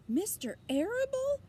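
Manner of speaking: high, loud sobbing question; a pig choking out disbelief through tears. Acted. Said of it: "sobbed Wilbur".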